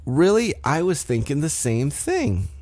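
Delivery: high-pitched mock surprise; playfully acted indignant disbelief; mock incredulous. Retelling an acted catty exchange.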